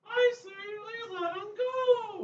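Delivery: high, slow squeaky plea; Pee-wee imitation. To the biker gang, a high, drawn-out Pee-wee plea. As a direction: beg like a cornered man-child. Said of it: "Pee-wee's on the ground and goes".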